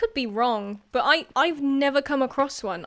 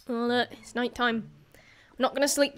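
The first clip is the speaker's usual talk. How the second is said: ordinary talk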